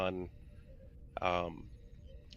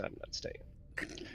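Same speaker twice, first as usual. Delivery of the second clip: whispered aside, much softer; 15 dB quieter, much slower than usual. Real, not acted. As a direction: drop to a breathy whisper for the punchline. Deadpan punchline about D and D snacks.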